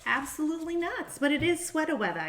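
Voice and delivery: playing up the New York accent for laughs. Reader teasing about her daughter, in mock New York accent. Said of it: "we gotta start talking like this".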